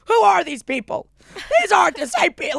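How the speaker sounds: high, loud exclamation of astonishment; acted disbelief. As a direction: cry it out in mock bewilderment. Acting out own stunned reaction.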